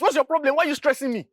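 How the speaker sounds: high, loud, fed-up outburst; exasperated shout. Acted. Brother losing patience with Loretta over the car, exasperated shout. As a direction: throw the question at her in exasperation.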